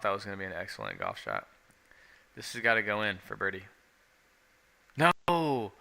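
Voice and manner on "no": sudden high dismayed yell; frustrated shout. Real, not acted. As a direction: cry out in real dismay. Golfer's putt just misses.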